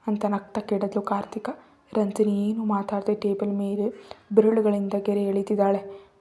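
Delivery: plain reading voice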